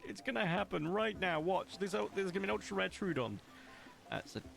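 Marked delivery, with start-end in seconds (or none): 0.1-3.4 high-pitched, giddy certainty; high hyped insistence. Acted. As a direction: insist it will happen, way up high. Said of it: "I'm not huffing the copium".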